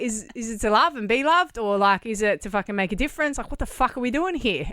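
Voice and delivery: half-mock outburst at the meaning of life; retold exasperated rant. To friend in hospital, a loud exasperated outburst. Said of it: "I was like".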